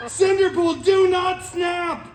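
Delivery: high, slow shouted plea; shouted warning. Acted. The Avengers shouting at Cinderpool not to snap, high and slow. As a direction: beg him high and drawn out not to snap. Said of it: "they all shouted".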